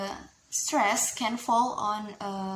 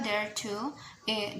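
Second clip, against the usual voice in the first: ordinary delivery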